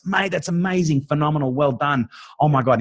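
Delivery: high-pitched gushing approval; excessive praise. Retold or imitated. Dad praising his son's good behavior, gushing. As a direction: heap it on like a delighted parent. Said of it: "giving him excessive levels of praise".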